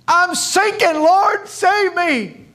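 loud, very high acted panic; desperate cry for help. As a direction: cry out like a drowning man begging rescue. Acts Peter crying out while sinking.

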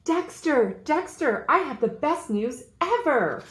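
high, loud, bursting with news; thrilled shout across the yard; excited call. Acted. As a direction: call out to a friend with big news. Character calls excitedly to friend.

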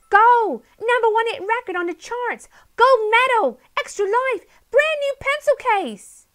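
very high, loud, fast cheers; joyful cheering. A narrator's reading. Polly cheers Jake's arrival, high and loud. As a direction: whoop out every prize.

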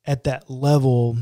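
ordinary delivery

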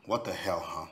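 soft, low repeat of her words; stung echo. Acted. Man taken aback by her outburst, stung quiet echo.

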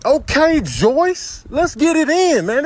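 normal talking voice

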